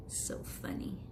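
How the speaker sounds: soft whispered giggle line. Real, not acted. Reader sharing a quiet joke with the child, whispered. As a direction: breathe it like a secret.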